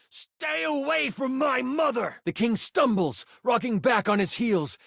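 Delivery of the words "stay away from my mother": high, strained protective yell. Acted. Prince defending his mother from the king, yelling.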